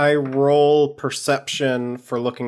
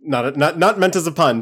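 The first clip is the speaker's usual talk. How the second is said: normal talking voice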